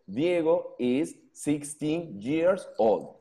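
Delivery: slow, careful model sentence; teacher spelling out the English. Shown as a demonstration. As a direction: lay out each word slowly. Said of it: "Así es como se arma en inglés".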